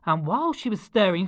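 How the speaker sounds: usual speaking voice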